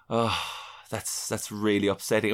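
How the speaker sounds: soft, low mock misery; mock dejected. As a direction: sigh it out, hammed-up gloom. Retold embarrassment, quoted self.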